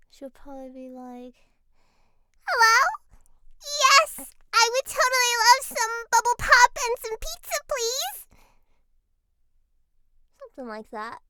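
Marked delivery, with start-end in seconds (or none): 2.5-8.3 put-on much higher and louder, a bubbly little girl; squeaky cartoon girl, overjoyed to order; sugary kid voice ordering pizza by phone; Bubbles voice.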